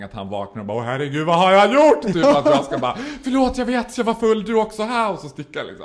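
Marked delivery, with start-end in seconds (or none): 0.8-5.1 higher, louder, flustered and laughing; mock panicked apology. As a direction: blurt the apology in a rush. Acted panicked apology scenario. (uppjagad, skrattande ursäkt)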